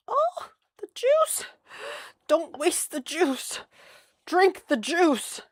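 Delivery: frantic over the juice; urgent squeal. Real, not acted. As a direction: plead for the juice not to spill.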